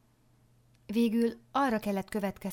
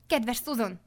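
higher-pitched, animated objection; voiced as an urgent, lively protest; 4 semitones higher, 4 dB louder than usual. A narrator's reading. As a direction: protest with sudden energy. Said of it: "tiltakozott élénken".

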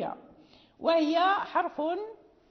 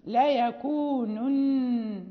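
drawn-out recitation, both nuns clearly sounded; slow recitation; 4 dB louder, much slower than usual. Shown as a demonstration. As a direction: recite لا يكونن very slowly. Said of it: "تكررت مثلًا".

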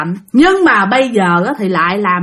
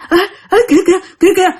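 high, urgent onlooker's call; alarmed calling out; 8 semitones higher, much faster than usual. Acted. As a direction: point it out in startled, repeated bursts. Onlookers point out something strange. (la lên chỉ trỏ hốt hoảng)

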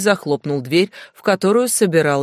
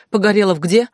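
plain reading voice

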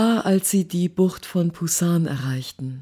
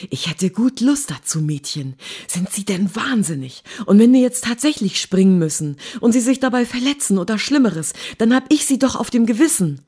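building to a loud, high, fast rebuke; agitated scolding; 6 semitones higher, 6 dB louder, faster than usual. A narrator's reading. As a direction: scold her with mounting alarm. Featherston schimpft aufgebracht mit ihr. (aufgebracht schimpfend, immer lauter)

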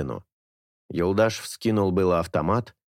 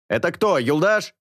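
higher, louder voice over the radio; walkie-talkie voice; 9 semitones higher, 3 dB louder than usual. A narrator's reading. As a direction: call through a crackling radio. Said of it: "спросил голос".

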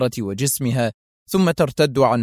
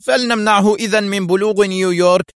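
a much higher, louder commanding shout; shouted order; 8 semitones higher, 4 dB louder than usual. A narrator's reading. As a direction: bark the order over the rotor noise. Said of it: "ثم هتف بالطيار".

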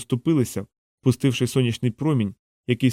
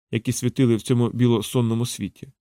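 ordinary delivery

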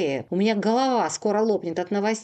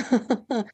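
short, breaking laugh; a helpless, teary laugh; hysterical laugh. Acted. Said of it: "истерически расхохотался".

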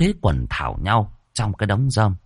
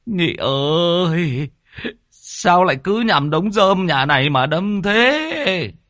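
higher, slow wailing complaint; shrieking; 10 semitones higher, slower than usual. Acted. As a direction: shriek at the reckless driver. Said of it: "Lão Thọt rú lên".

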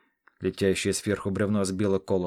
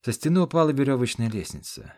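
ordinary delivery, nothing marked

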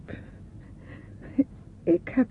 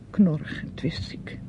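slow, hissed whisper of resentment; bitter whisper; 3 semitones lower than usual. Acted. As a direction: spit it out under your breath. Bitter gefluister over Eduard. (bitter en traag gefluisterd)

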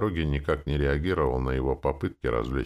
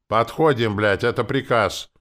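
loud, higher-pitched military command; barked order; 8 semitones higher, 7 dB louder than usual. A narrator's reading. Commander orders his men to step up, commanding. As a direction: order the men forward with force.

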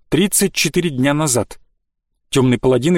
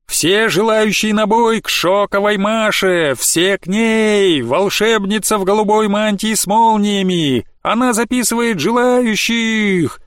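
high, slow barker call; 6 semitones higher, slower than usual. Acted. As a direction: call out to the crowd. Crier calling out recruits.

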